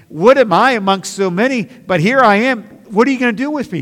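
loud, high, pleading prayer; impassioned preaching. Acted. Preacher voicing a humble believer's plea, loud and high. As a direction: cry it out as a plea to God.

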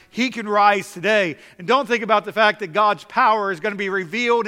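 loud, urgent preaching; preaching at full force. Acted. Preacher urging the church to trust Jesus today, loud and pleading.

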